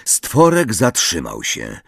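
plain reading voice

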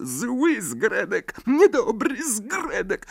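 high, shrill elf wail, slow; a squeaky elf punishing himself; screaming house-elf. A narrator's reading. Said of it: "wrzeszcząc". (piskliwy skrzat wrzeszczy)

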